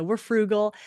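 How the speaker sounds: ordinary talk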